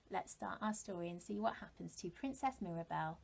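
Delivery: ordinary delivery, nothing marked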